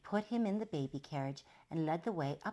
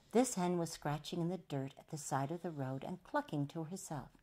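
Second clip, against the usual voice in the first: plain reading voice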